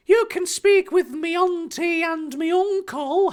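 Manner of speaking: put-on very high little boy voice; Harry, politely. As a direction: answer in a polite, squeaky child's voice. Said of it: "retorted politely".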